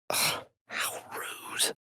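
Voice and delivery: slow, whispered annoyed aside. Acted. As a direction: mutter it under your breath, irritated. Irritated aside under breath.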